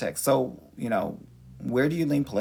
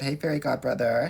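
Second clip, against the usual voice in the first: ordinary talk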